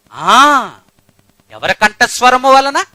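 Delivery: slow, loud, high proclamation; drawn-out preacher's roar; booming preaching. Acted. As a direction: thunder it out from the pulpit. Preacher demands congregation's answer.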